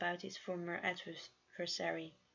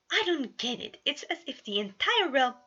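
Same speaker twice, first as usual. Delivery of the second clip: frustrated; 6 semitones higher, 8 dB louder than usual. Acted. Roman frustrated, puzzling aloud.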